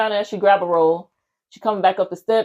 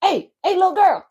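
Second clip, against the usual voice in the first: high-pitched imitation of a man calling out; mock yelling; 11 semitones higher than usual. Man running at her down the street, mock yelling. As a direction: mimic a yell without full volume. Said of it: "This man just yelling".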